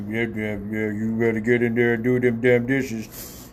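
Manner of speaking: imagined deep-voiced dad scolding; Mister Motherfucker. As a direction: mimic his low monotone telling off the kids. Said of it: "he got kids like I do".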